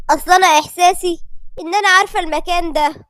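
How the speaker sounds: ordinary delivery, nothing marked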